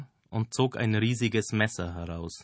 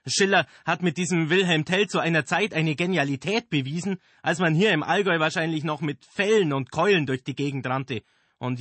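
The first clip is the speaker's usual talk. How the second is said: high, loud theatrical rant; ranting director; 8 semitones higher, 5 dB louder than usual. Acted. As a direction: lecture them grandly and loudly. Director rants at the actors.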